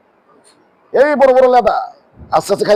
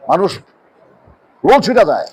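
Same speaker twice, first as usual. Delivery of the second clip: ordinary talk, nothing marked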